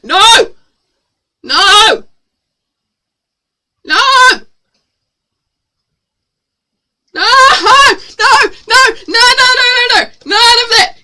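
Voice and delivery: high, loud repeated no; shouting no. Real, not acted. Shouting no at chat spamming pies. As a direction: yell them off.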